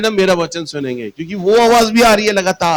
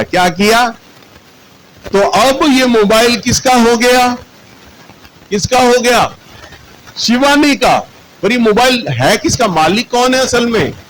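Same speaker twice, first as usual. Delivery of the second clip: higher, louder preacher's call to the crowd; rising preaching; 7 semitones higher, 3 dB louder than usual. Acted. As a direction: drive the questions home to the congregation.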